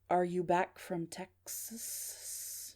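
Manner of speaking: very slow, soft, measured caller voice; eerie, slow phone voice; mysterious caller. Acted. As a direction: draw it out softly, hanging on the last sound.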